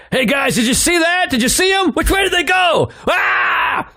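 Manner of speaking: high-pitched frantic shouting, ending in a yell; freaking out. Retold or imitated. Frantic man runs up to the truck, freaking out. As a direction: act out a panicked man shouting for help. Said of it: "He's freaking out".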